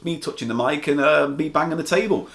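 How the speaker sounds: ordinary talk, nothing marked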